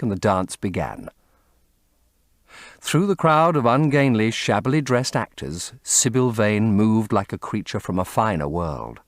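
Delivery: ordinary delivery, nothing marked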